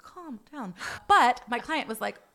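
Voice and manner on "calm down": very soft, slow aside; mock hushed scolding. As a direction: rein in someone overexcited under your breath. Boss hushes the excitable assistant.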